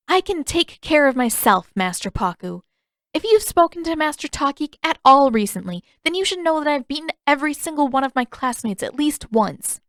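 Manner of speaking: defiant Katara. A narrator's reading. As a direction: insist she can handle herself.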